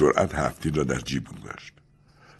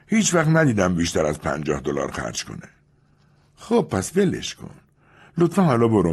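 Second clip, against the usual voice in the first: put-on higher, dismissive young woman; Julia's voice; 7 semitones higher than usual. Narrator voicing Julia, brushing off the gentleman. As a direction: toss him off with disdain.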